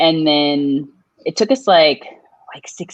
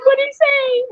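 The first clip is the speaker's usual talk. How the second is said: very high, crying-pitched question; mock tearful. Asking her homegirl what he said, mock tearful. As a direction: pretend to plead through tears. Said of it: "I started crying".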